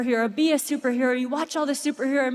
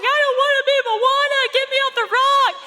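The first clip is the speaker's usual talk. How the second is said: put-on high, frightened little girl pleading; scared child crying out; 12 semitones higher, 5 dB louder, faster than usual. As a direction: imitate a terrified child begging to get out. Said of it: "She's like".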